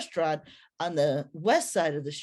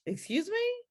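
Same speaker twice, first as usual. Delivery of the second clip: surprised; 13 semitones higher, 3 dB quieter than usual. Retold or imitated. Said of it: "the question throws me off".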